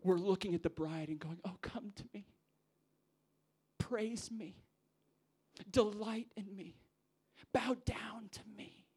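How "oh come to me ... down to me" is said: breathy come-hither whisper; seductive beckoning. Acted. Leaders luring the bride to themselves, whispered and seductive. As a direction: coax her to you in a whisper. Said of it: "we beckon the bride to ourselves".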